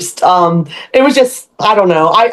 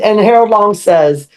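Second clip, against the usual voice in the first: ordinary talk, nothing marked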